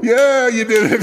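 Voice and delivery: loud, high, drawn-out congratulations; high, stretched-out celebration; triumphant cheer. Real, not acted. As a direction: cheer them on loudly. Cheering the remembered name.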